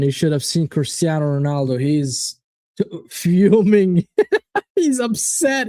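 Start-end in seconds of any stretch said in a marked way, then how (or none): 4.1-4.7 high burst of laughter. Real, not acted. Laughing at Ronaldo fuming.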